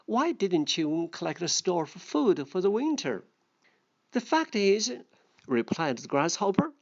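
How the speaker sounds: a lightly voiced storybook reading; story reading. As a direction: read the fable aloud to the class. Second read of the fable.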